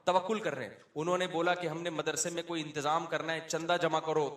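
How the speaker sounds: usual speaking voice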